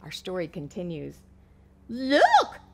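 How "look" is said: higher clucking call; clucking hen. Acted. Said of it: "clucked the hen".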